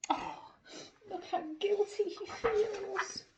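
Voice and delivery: ordinary delivery, nothing marked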